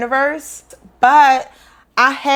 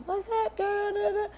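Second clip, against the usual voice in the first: much higher and much softer, a silly sing-song hello; mock crazy greeting; 9 semitones higher, 13 dB quieter than usual. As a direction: lampoon someone acting wild about their spirituality. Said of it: "Not in a crazy way".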